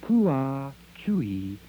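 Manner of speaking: high chirping squawks, one bird after another; bird calls. Acted. Birds of America answer with high bird calls. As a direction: call out like a flock of ducks, owls and songbirds. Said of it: "said the birds of America".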